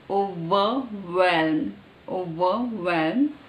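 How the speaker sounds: OVERWHELM slowly, syllable by syllable, V then W; slow articulation. Shown as a demonstration. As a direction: stretch out OVERWHELM, separating its sounds. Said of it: "Now overwhelm".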